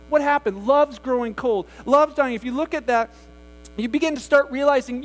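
ordinary talk

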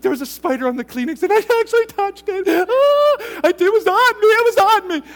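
high, loud, frantic retelling; imitating the wife in a high, loud panic about a spider; panicked wife. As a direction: blurt it out in disgusted panic. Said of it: "she's like".

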